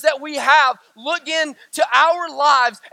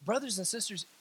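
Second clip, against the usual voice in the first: ordinary talk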